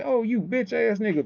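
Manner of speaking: high scornful jab; mock insult. Typing an insult at the host, high scorn. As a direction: sneer it up high in disbelief. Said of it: "I said".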